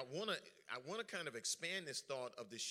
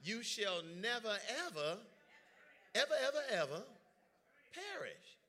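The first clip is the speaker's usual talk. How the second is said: each "ever" louder and higher, peaking before "perish"; building emphasis; 6 semitones higher, 3 dB louder than usual. Acted. Preacher insisting salvation is never lost.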